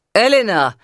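loud call down a corridor. A narrator's reading. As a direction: call her name from afar. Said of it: "rief jemand auf dem Korridor".

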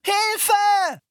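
high, loud cry for help; a girl stuck in mud screaming for help. A narrator's reading. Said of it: "schrie Anne".

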